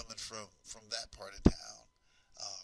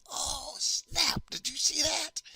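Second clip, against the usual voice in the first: much higher, loud excited squeal; excited kids screaming; 8 dB louder, much slower than usual. Retold or imitated. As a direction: squeal like a classroom of kids who just saw a fight. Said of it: "They're screaming".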